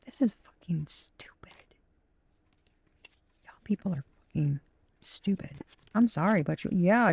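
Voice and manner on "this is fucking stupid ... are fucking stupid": hushed, breathy muttered insult; whispered grumble; whispered aside. Real, not acted. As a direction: say it under your breath so nobody hears. Muttered insult, then apology.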